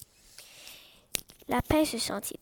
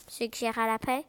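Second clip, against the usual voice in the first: ordinary delivery, nothing marked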